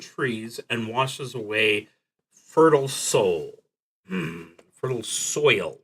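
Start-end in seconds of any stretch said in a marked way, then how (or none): none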